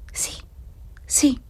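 high, faint, trembling yes; tremulous whispered assent; breathed, trembling. A narrator's reading. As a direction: breathe the words out fearfully, with a pause between. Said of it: "soffiò, tremando la voce".

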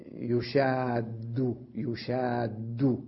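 يشاد said very slowly, each sound drawn out; slow pronunciation demo. Shown as a demonstration. Teacher slowly sounding out يشاد as an example. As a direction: stretch out يشاد for the students to hear. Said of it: "أخذنا كلمة".

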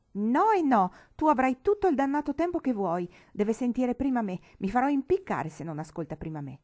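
loud, high, stubborn outburst; litigio rabbioso. Acted. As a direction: insist at full voice on being heard first. Lite tra sorelle per parlare prima. (protesta rabbiosa, forte e acuta)

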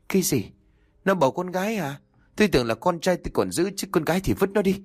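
high-pitched, indignant disbelief; shocked disbelief. Acted. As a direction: react with dismay, pitch shooting up. Acted shock at granddaughter's birth. (giọng cao, sửng sốt bất bình)

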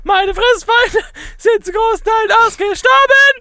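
sing-talk as a tiny hedgehog; high hedgehog voice. Pretend.

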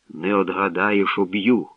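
ordinary delivery, nothing marked

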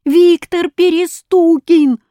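high, loud, drawn-out cry; loud accusing chant; chorus shout. A narrator's reading. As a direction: shout it like a crowd of boys. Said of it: "хором ответили мальчишки".

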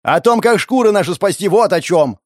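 loud, high angry retort; yelling back. A narrator's reading. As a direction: yell back in a heated quarrel. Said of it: "заорал в ответ".

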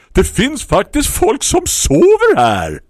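annoyed shout to wake-up noise; shouting over the noise. Acted. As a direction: yell out the window at the noisy neighbour. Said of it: "skrek för att överrösta".

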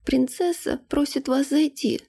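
put-on a servant girl politely passing on a summons; maid. A narrator's reading. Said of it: "не сдавалась служанка".

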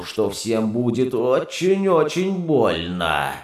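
put-on slow, eerie childlike singsong; off-key nursery singsong. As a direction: sing it like a broken nursery rhyme. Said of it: "как в детской песенке, спетой фальшиво". (жуткий фальшивый напев)

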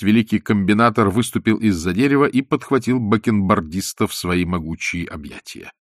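ordinary delivery, nothing marked

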